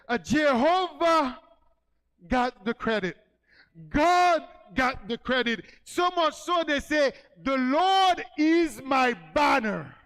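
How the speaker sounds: loud, high and slow, building to a shout; preaching at full force. Acted. Pastor to congregation, loud slow preaching shout. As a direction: proclaim it to the whole church, drawing the words out.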